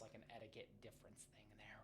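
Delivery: whispering; whispered aside. Real, not acted. Aside about road etiquette.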